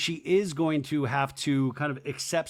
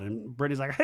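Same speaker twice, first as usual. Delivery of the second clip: ordinary talk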